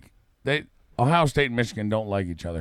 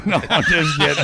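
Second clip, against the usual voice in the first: higher, laughing take-back of a joke; 4 semitones higher, 4 dB louder than usual. Real, not acted. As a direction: laugh it off after teasing. Laughing after own porno joke.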